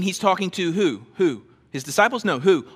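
ordinary talk, nothing marked

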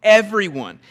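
loud, emphatic answer. Real, not acted. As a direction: lift your voice on Everyone. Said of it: "so emphatic about it".

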